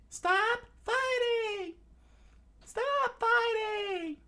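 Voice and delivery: repeated falsetto screams, stretching longer each time; desperate drawn-out princess shriek; Phoebe. Acted. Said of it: "her screaming from".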